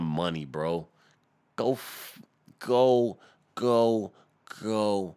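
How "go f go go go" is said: stuttering on go; stuck repeating the word. Real, not acted. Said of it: "I stutter a lot".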